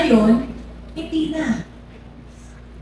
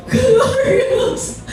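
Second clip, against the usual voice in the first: much higher, bursting exclamation with a laugh; mock joyful pastor exclaiming; exclaimed name; 10 semitones higher than usual. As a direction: cry out the name in delight. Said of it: "Tapos sabi ng pastor".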